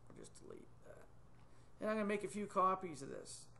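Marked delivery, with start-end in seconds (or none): 0.1-1.1 soft whisper to himself after a slip; whispered aside. Real, not acted. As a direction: breathe it out under your breath. Muttering after a mistake.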